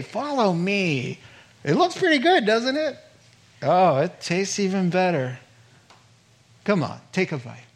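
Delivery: hammed-up seductive tempter; tempting serpent. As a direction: lure her in like the serpent. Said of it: "You know that line".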